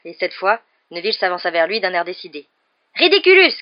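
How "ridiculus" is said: high, loud shouted spell; shouting. A narrator's reading. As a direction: shout the incantation with decision. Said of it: "cria-t-il". (cri fort et aigu)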